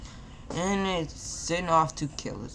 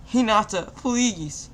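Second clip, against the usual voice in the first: higher, louder desperate plea; crying out; 7 semitones higher, 5 dB louder, slower than usual. A narrator's reading. Naruto pleading with dying Hinata, desperate cry. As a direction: beg her to wake up. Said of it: "Naruto cried".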